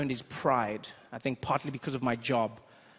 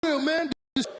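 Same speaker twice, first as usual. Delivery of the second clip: high, loud, hammed-up street greeting; mock cool guy greeting a friend; 11 semitones higher, 5 dB louder than usual. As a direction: hype him up like he's the real deal. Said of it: "They just".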